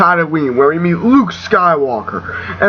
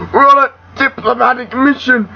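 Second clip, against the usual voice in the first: high, strangled insistence; choked captive; 7 semitones higher than usual. Acted. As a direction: protest as if held by the throat. Choked captive answers Vader.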